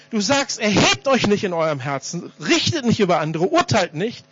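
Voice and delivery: lautes, eindringliches Bußgebet; inbrünstiges Gebet. Real, not acted. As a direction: bete laut vor der Gemeinde, bekennend. Leads public prayer. (flehentliches öffentliches Gebet)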